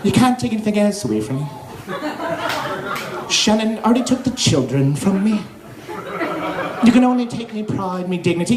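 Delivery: mournful put-on Irish accent; self-pitying Irish accent; depressing Irish accent. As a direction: play a defeated, woeful Irishman. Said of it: "If you go Irish, it's depressing".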